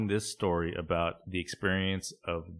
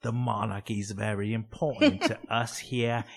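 ghostly British. Pretend. Ghost scolding Adrian Laura over the Queen, slow ghostly British accent. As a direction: moan it like a mock British ghost. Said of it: "ghost British person".

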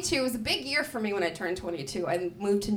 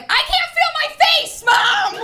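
high, loud panicked yell to mom; panicked shout; 12 dB louder than usual. Retold or imitated. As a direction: shout it while wired on pills. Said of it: "and I was like".

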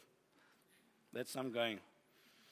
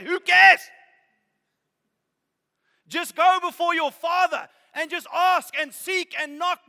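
high, loud preaching at full force; fervent preaching; 11 semitones higher, 9 dB louder than usual. Acted.

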